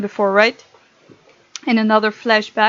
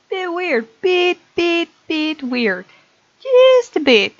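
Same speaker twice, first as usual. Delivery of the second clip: much higher and louder, pressing the point; emphatic insistence; 5 semitones higher, 3 dB louder, slower than usual. Real, not acted. Gamer insisting the age gap is weird, emphatic. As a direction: push it up high, just a bit.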